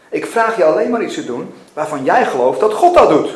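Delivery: loud, emphatic confrontation; forceful challenge. A narrator's reading. God confronting Mackenzie about judgment, loud forceful challenge. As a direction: press the point hard, punching key words.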